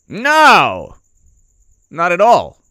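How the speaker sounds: mock denial.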